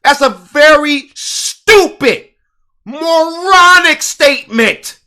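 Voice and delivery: drawn-out disgusted verdict; scornful emphasis. Real, not acted. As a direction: spell out the contempt slowly. Angry rant about police excuse.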